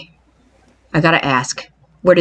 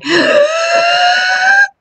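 shocked gasp. Real, not acted. Discovering skeletons in the basement, a shocked high gasp. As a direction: gasp sharply in horror.

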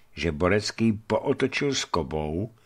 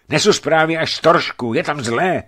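high, loud, alarmed announcement; urgent news; 8 semitones higher, 7 dB louder than usual. A narrator's reading. Rider bringing bad news to the watchman, urgent.

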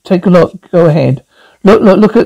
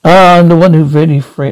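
usual speaking voice

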